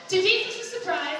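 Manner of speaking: ordinary delivery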